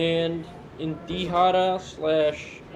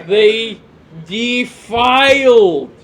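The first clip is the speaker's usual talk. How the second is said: loud, high and slow outraged cry; mock outrage; 3 semitones higher, 10 dB louder, much slower than usual. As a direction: wail it in hammed-up outrage. Mock outrage at card art.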